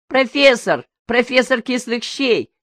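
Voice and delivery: high, bright-pitched teasing cry; teasing shout. A narrator's reading. Little sister teasing Misha as a professor, shouting. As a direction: yell it like a little sister mocking. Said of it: "крикнула младшая девочка".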